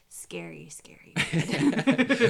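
slow, eerie whisper; spooky whisper. Pretend. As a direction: breathe it out like a ghost story. Recalling the same character, scarier.

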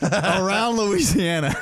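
high, drawn-out mock punchline; Tom Segura. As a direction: land it slowly, imitating a comedian. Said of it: "he's like".

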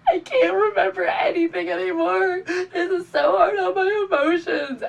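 high, teary, laughing-crying wail; mock sobbing. Pregnant on the roadside, sobbing to her wife. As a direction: play the overwhelmed sob for laughs. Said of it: "I just start sobbing".

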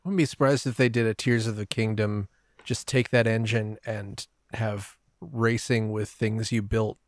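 ordinary delivery

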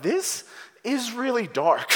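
high, slow, disturbed realization; acted kid taken aback; mock dismay. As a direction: draw it out high in mock unease. Acting a kid disturbed by film.

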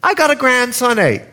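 high-pitched Bahamian grandmother; Bahamian accent. Retold or imitated. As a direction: imitate an old Bahamian granny, pitched high. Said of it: "She's Bahamian, excuse the accent".

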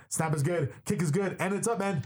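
play-by-play kick call, climbing in pitch; Ben Paul. Retold or imitated. Broadcaster imitating booth partner's field goal call, rising excitement. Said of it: "hear Ben Paul".

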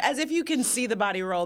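ordinary talk

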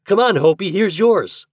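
high, loud urging call; excited cry. A narrator's reading. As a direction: call a friend over eagerly. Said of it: "cried Herbie".

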